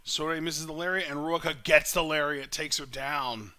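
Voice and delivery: lively live play-by-play call; wrestling commentator. Real, not acted. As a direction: call the match as it happens.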